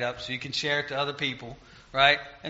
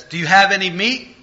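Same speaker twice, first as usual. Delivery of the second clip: loud call, higher, flung across the water; called-out yell; 4 semitones higher, 9 dB louder than usual. Retold or imitated. Christ calling out to the men on the fishing boat, a loud call. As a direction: shout it over to the boat like calling to fishermen. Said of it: "yells over and says".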